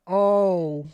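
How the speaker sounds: horrified reaction, slowing on the question; dismayed. Retold or imitated. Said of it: "I just sat there like".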